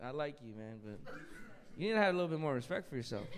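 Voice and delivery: low, soft quoted rejection; imitated gentle letdown. Comic retelling a woman's rejection of a man, soft letdown. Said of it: "she was like".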